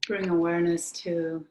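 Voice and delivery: calm meditation voice with very long pauses; guided meditation. Real, not acted. Yoga teacher guiding the class to notice breath, guided meditation. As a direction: lead them inward, leaving long silences between phrases.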